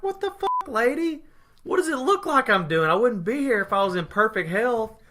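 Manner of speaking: high-pitched indignant protest, coming down gradually; exasperated mock retort. Patient snapping back at ER staffer, mock exasperated.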